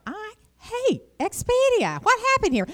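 high, slow exasperated groan of a line. Retold or imitated. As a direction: sound fed up with the website. Frustrated booking a flight.

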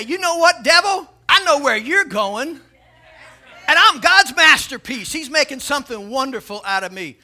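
loud, high declaration of defiance; defiant preaching. Acted. Believer answering the devil as God's masterpiece, defiantly preached. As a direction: throw it back at the enemy.

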